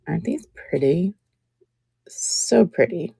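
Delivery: soft breathy whisper of delight; whispered admiration. Real, not acted. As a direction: breathe it out in awe.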